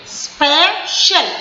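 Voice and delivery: Special slowly, spe... shial; two separate stresses. Shown as a demonstration. Teacher showing the jaw dropping twice, special split slowly. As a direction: break special into two long beats. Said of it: "दो बार करेंगे".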